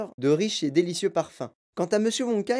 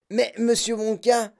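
put-on slow, higher boy's voice; boy objecting; 6 semitones higher than usual. A narrator's reading. Mike Teavee interrupting Wonka with an objection. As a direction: object like a know-it-all boy. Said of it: "dit Mike Teavee".

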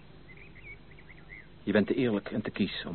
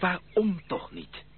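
pleading; 10 semitones higher, 3 dB louder, much slower than usual. Acted. Suitor pleads after her refusal.

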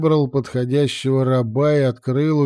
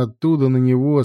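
usual speaking voice

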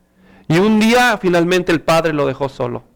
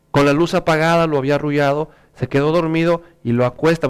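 normal talking voice

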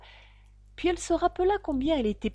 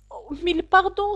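high, louder cry of apology; hasty apology; 8 semitones higher, 7 dB louder than usual. A narrator's reading. Alice fears she hurt the poor animal, hasty apology. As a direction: blurt it out, anxious to make amends. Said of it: "s'écria vivement Alice".

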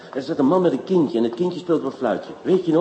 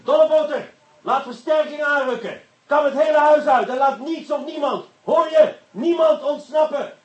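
much higher, loud commanding shout; shouted orders; 11 semitones higher, 3 dB louder than usual. Acted. Inspector shouting commands at Dolleboter, loud and urgent. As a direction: bark the orders across the house.

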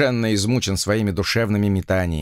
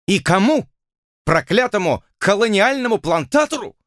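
indignant lady; 9 semitones higher than usual. A narrator's reading. Arabella's indignant outburst.

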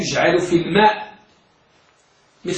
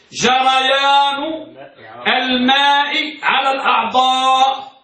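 very slow, drawn-out dictation, pitched up; تلقين بطيء; 4 semitones higher, slower than usual. Shown as a demonstration. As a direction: stretch each word for students to follow. Teacher dictates definition slowly. (تلقين ببطء ومدّ الكلمات بنبرة أعلى)

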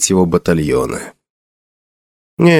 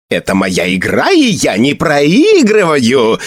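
put-on slow boastful old man; 4 semitones higher than usual. Hermit boasts before cackling.

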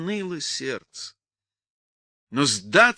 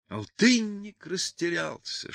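usual speaking voice

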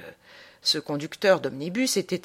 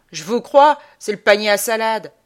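higher, much louder bystander voice; loud emphatic reply; 4 semitones higher, 9 dB louder than usual. Acted. Bystander answering the traveller's question about the omnibus, loud and emphatic. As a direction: answer loudly and emphatically, pitched up.

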